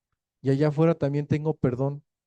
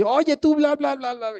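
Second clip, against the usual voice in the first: mock home quarrel; 12 semitones higher than usual.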